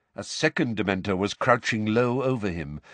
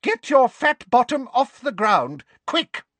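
put-on high, loud scolding old woman; yelling; 5 dB louder than usual. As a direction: bark the order at him. Mrs Figg yelling at Dudley.

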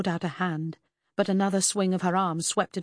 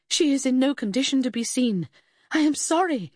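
high, urgent plea; desperate; 7 semitones higher, 4 dB louder than usual. A narrator's reading. Investigator trying to stop mother viewing body, desperate. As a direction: plead urgently, pitched well up. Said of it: "I tried desperately".

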